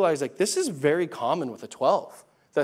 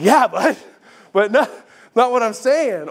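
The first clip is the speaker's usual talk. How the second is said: louder, higher, exasperated objection; exasperated protest; 6 semitones higher, 6 dB louder than usual. Retold or imitated. Dad to son who changes the subject, exasperated and louder. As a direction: cut him off, frustrated.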